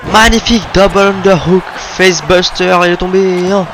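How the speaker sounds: high, loud play-by-play call; excited commentator shout. Real, not acted. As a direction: call the move with rising excitement.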